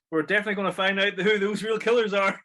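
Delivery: hammy promise at a funeral; imitating Homer. Homer giving the eulogy, laughing mock vow. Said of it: "he's like".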